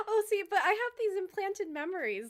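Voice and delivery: high, laughing protest. Real, not acted. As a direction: giggle through the line. Laughing reply.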